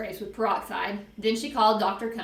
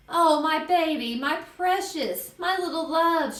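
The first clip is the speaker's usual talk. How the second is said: high, slow, doting fussing; gushing motherly distress; cooing mother; 8 semitones higher, 3 dB louder, slower than usual. Acted. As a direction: smother the hurt child with love. Mother fusses over hurt child.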